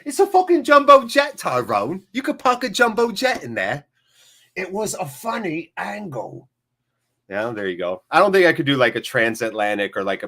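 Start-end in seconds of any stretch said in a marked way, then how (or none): 0.1-3.9 high, loud mock London accent; hammed-up, high-pitched London gangster-film accent; Snatch London accent. Streamer quoting Snatch for his chat, high London accent. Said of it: "Can I just quote Snatch movies?". 4.6-6.5 higher, softer put-on London accent; Snatch London accent. Streamer quoting Snatch for his chat, London accent. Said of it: "Can I just quote Snatch movies?".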